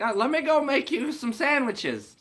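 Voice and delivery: put-on very high little old lady voice; granny greeting a masked stranger; sweet granny. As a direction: chirp it like a doting grandma. Granny offers sandwiches.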